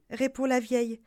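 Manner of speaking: ordinary delivery, nothing marked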